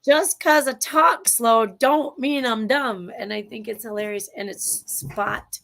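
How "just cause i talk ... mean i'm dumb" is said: put-on slow, drawn-out Southern accent; Southern drawl. Host quotes Sweet Home Alabama in a slow Southern drawl. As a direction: drawl it out like a Southern movie hero. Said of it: "he goes".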